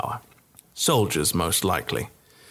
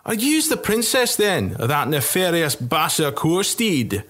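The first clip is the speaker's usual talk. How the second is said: a boozy soldier confronting the princess; rough drunk soldier; 8 semitones higher than usual. Acted. Soldier questions April.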